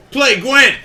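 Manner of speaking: loud high shout across the pub. Real, not acted. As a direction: yell it at him. Calling out to a stranger.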